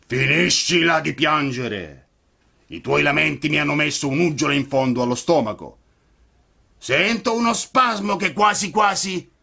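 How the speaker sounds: gruff showman yelling; gruff shout. A narrator's reading. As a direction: bellow it like a softhearted ogre. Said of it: "gridò a Pinocchio".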